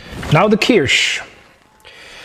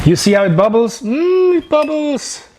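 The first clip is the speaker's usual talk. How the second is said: delighted; 10 semitones higher than usual. Real, not acted. Delighted at bubbling fondue.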